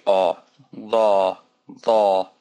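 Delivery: la with an open, heavy ah vowel; heavy alif ah sound. Shown as a demonstration. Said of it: "So a and ah".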